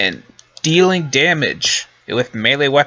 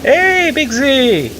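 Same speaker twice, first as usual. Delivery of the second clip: excited; 14 semitones higher, 3 dB louder, much slower than usual. Real, not acted. Greeting a returning companion.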